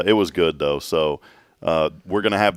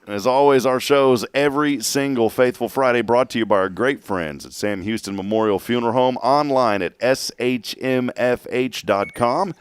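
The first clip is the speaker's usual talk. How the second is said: loud, upbeat sponsor read; radio announcer; 6 semitones higher, 4 dB louder than usual. Acted. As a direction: sell the sign-off.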